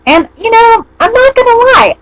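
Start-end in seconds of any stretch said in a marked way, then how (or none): none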